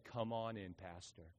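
very soft, low, near silent; the grieving mother quietly letting him in; broken whisper-soft. Retold or imitated. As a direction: let the words barely come out, spent. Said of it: "And she said".

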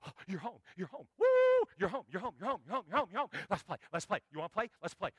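overjoyed dog greeting, rapid repeated phrases; excited dog. Acted. Dog greeting owner home from work, excited. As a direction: gush breathlessly like a dog wanting to play. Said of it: "she looks, she goes".

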